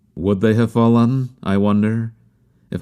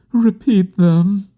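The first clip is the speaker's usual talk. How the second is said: high, slow, heartbroken plea; heartbroken murmur; 12 semitones higher, 4 dB louder, slower than usual. A narrator's reading. Bereaved woman begging for his last words, high and heartbroken. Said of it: "she murmured in a heartbroken tone".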